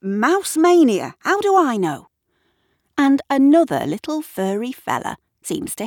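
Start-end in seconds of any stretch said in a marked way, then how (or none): 0.0-2.1 raised, higher-pitched defensive retort. A narrator's reading. Gavin answers Kat's demand, defensive. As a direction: protest that you're no expert. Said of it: "says Gavin defensively".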